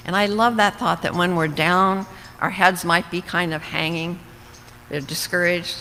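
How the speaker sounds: usual speaking voice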